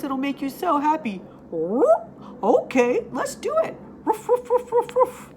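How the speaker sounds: high, bouncy puppy voice; high eager dog talk; excited dog voice. Acted. Dogs chatting in story.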